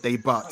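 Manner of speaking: "debut" as "day-butt" with final T; joke mispronunciation. Pretend. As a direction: sound the silent T in "debut". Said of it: "'cause there is a T at the end".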